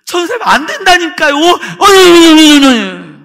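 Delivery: high, loud mock wail; imitated crying voice. Crying boy pleading with the evangelist, imitated crying voice. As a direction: protest loudly, breaking into exaggerated wailing. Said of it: "우는 소리로".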